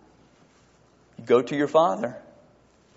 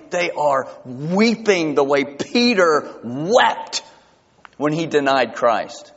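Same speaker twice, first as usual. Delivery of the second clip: ordinary talk, nothing marked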